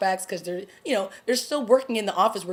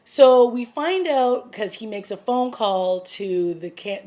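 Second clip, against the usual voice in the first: normal talking voice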